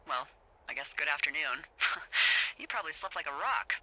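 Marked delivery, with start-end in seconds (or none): 1.7-2.5 brief voiceless chuckle; a quick puff of laughter; short amused laugh. Acted. Lookout amused that it is already afternoon, short chuckle. Amused at greeting him late.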